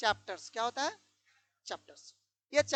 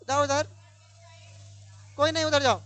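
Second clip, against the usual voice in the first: loud classroom order; 7 dB louder than usual. Real, not acted. Teacher ordering pupil to move.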